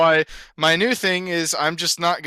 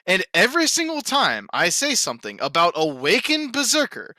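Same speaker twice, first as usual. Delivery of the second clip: ordinary talk, nothing marked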